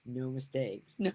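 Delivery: usual speaking voice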